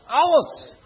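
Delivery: Alles shouted out high and loud. Shown as a demonstration. Preacher urging the congregation to repeat it, shouting Alles. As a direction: call out Alles to lift the room. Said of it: "zegt iemand alles?".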